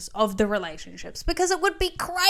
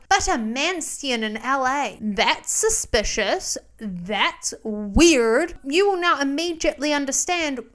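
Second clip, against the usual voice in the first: ordinary talk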